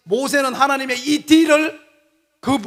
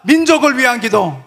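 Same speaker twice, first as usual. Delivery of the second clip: usual speaking voice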